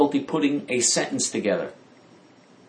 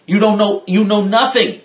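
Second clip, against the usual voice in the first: higher and louder, emphatic rant; heated outburst; 8 semitones higher, 6 dB louder than usual. Real, not acted. Warning the audience about Trump, raised voice. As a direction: hammer home that they know nothing.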